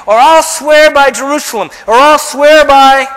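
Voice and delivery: a hammed-up hypocrite vowing by heaven; mock Pharisee. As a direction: play the glib Pharisee swearing lightly. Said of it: "This is the Pharisees now talking".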